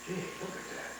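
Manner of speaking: breathy near-whisper of surprise, barely voiced; soft whispered gee, half to himself; hushed aside. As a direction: whisper it like you don't want to be heard. Retold hushed remark from fortress.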